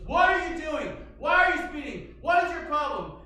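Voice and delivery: high, loud yelling cop; furious shouting officer; angry cop shout. Retold or imitated. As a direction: bark at him like an alarmed cop. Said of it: "And he goes".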